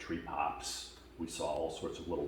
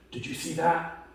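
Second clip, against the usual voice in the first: higher and louder, urgent; startled question; 11 semitones higher, 8 dB louder than usual. Retold or imitated. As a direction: ask it wide-eyed and alarmed. Friend's startled question retold.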